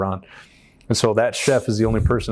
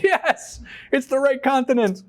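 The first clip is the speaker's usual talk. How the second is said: very high, hammed-up cheer; retold elation; slower than usual. Rowers hearing they are bound for Canada, hammed-up elation. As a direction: celebrate in an exaggerated high squeal.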